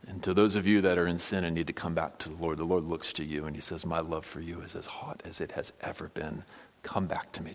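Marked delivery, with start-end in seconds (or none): 3.8-7.5 tender plea. Pretend. Voicing the Lord's tender call.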